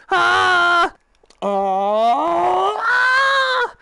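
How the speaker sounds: loud high "ah", rising wail; drawn-out high yells; freaked-out screams. Real, not acted. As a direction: scream out, sliding up to a high pitch. Freaked out by passing through hands.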